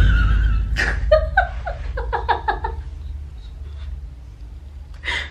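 breathless high-pitched cackle; shrieking wheeze of a laugh; high wheezing laugh. Real, not acted. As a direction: laugh helplessly, almost silent. Huge laugh.

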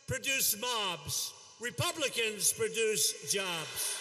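slow, weighted contrast on mobs and jobs; rally chant-like punchline. Acted. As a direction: land each word of the rhyme.

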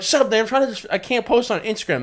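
high, loud retold telling-off; exasperated scolding. Nephew to uncle ruining his video, exasperated scolding.

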